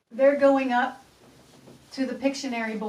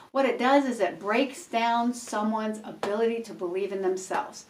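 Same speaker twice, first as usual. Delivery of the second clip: normal talking voice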